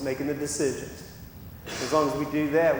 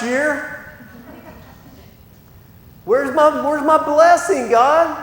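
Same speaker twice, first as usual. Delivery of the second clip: much higher, louder whiny demand; hammed-up entitled whining; mock entitled complainer; 11 semitones higher, 10 dB louder, slower than usual. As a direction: whine at God like a spoiled kid. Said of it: "can't just do one random act of kindness and go".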